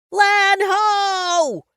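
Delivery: put-on very high, loud little-boy shriek; child's shriek. Little brother shrieking for his big brother's attention. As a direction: shriek it like an excited kid. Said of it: "Jack shrieks".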